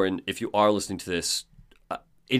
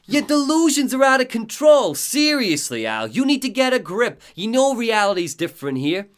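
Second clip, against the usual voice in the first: high, loud, exasperated sidekick voice; hammed-up friend telling someone off; Barry; 10 semitones higher, 6 dB louder than usual. Friend telling Alan to get a grip, loud exasperation. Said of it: "Barry says".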